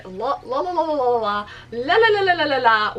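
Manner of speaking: rapid, rising la-la gibberish for endless gushing; babbling la-la-la. Retold or imitated. Fan gushes on about actor to friend, babbling. Stands in for her gushing rant.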